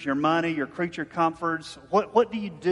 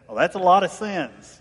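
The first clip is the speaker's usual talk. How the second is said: mocking quip; 3 semitones higher, 7 dB louder than usual. Profane man mocking the pastor.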